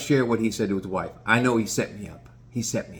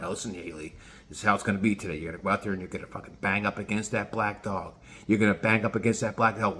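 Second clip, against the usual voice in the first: low, softer mock instructions; lightly voiced conspiring owner, pitched down; owner coaching dog; 5 semitones lower, 6 dB quieter, faster than usual. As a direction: give hushed mock orders to a dog. Owner secretly coaching his dog.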